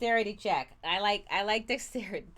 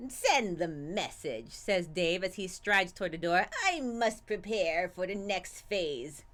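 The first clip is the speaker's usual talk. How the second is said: slow, low villain menace; menacing villain; 3 semitones lower than usual. Acted. Voices villain Dave.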